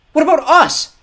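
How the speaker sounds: put-on very high, loud appalled boy; horrified. A narrator's reading. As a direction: cry out in horror at the betrayal. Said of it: "Klaus asked in horror".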